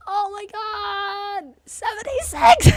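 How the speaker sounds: high, loud delighted squeal; excited shriek. Real, not acted. Excited about subscriber count.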